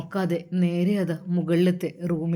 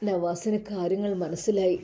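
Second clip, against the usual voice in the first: ordinary delivery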